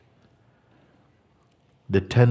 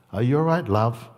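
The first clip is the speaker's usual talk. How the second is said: higher, louder solicitous check-in; concerned other person; 6 semitones higher, 5 dB louder than usual. Acted. Someone checking on a peaceful person, acted concerned voice. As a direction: ask with lifted, fussing concern. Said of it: "will come even through other people".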